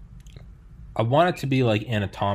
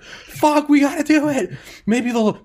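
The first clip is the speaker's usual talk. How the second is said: high, loud mock desperation; frantic, pitched-up imitation of terror; acted panicked screaming; 5 dB louder, slower than usual. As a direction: cry it out in hammed-up panic. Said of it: "I want you screaming and crying".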